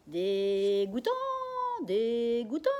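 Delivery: loud, slow parrot squawk; parrot repeating. Acted. Parrot Jaco echoing the daughter, loud squawk. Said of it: "répète Jaco".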